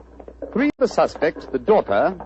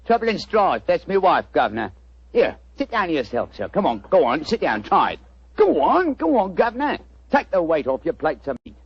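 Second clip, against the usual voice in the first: chirpy cockney delivery pitched up; cockney disguise; 3 semitones higher than usual. Acted. Disguised detective greets the homeowner in cockney. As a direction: play a cheeky London workman. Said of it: "That's rhyming slang".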